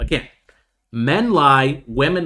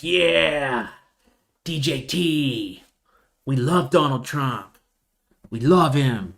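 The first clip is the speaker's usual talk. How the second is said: mock hyped fan; 2 semitones higher, much slower than usual. Mocking hyped retail crowd.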